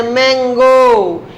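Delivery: मैंगो stretched out very slowly; slow teaching model of मैंगो; demonstrated pronunciation. Shown as a demonstration. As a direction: draw out मैंगो from its opening m. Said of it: "मौ से उच्चारण कर रहे हैं".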